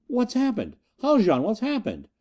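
high-pitched, alarmed demand; frightened crewman's cry; panicked question. A narrator's reading. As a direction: blurt it out high, desperate for news.

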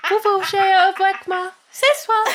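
high, hammed French voice; sing-song fake French come-on; mock French. Joke French line to cats.

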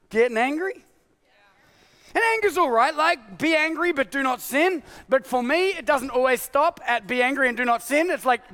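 usual speaking voice

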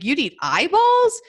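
loud, high-rising mock disbelief; incredulous question. Mother reacts to her son's eyeball offer, incredulous. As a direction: repeat it back as if you can't believe it.

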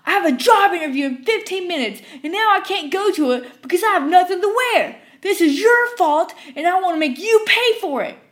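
furious customer shrieking; screaming. Retold or imitated. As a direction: scream it waving the cup. Rabid customer yelling.

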